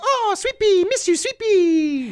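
put-on very high cooing baby talk; doggy baby talk. Owner missing her dog, very high cooing. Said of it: "I'm like".

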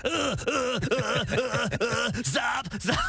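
breathless gasping cries of stop, pitched high; mock winded gasps. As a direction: imitate the grape lady gasping for air. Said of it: "And she's like".

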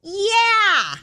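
put-on high, drawn-out toddler shout; little toddler voice. Toddler in church balcony answering pastor, high little voice. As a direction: pipe up like an eager little kid, high pitch. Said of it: "the little voice goes".

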